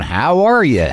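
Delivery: lightly put-on Southern American accent; hint of an American Southern drawl; imitated Southern accent. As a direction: mimic a Southern dialect tape. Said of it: "get tapes of people going".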